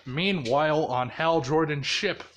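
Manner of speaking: ordinary delivery, nothing marked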